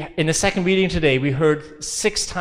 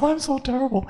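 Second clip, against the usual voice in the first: "I'm so terrible" much higher, whiny and soft; mock self-pity; 10 semitones higher, 3 dB quieter than usual. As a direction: ham up a sorry-for-yourself moan. Dancing around myself in self-pity.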